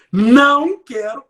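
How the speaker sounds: não drawn out, loud and rising; stress on não. Shown as a demonstration. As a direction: hold the word não long and loud. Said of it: "eu usei a palavra não". (ênfase no não, lento e forte)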